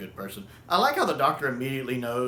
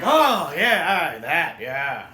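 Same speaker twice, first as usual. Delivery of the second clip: bluffing Jamie; 3 semitones higher, 7 dB louder, much slower than usual. Retold or imitated. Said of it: "and Jamie's like".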